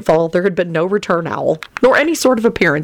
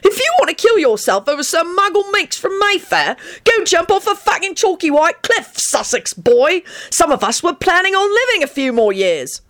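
high-pitched angry tirade; shouted; 13 semitones higher than usual. A narrator's reading. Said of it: "Sirius shouted".

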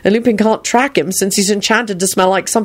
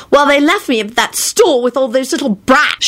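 put-on higher, indignant girl; angry; 7 semitones higher than usual. A narrator's reading. Daughter complaining to her father, angry. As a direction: complain crossly, pitched up. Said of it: "she finished angrily".

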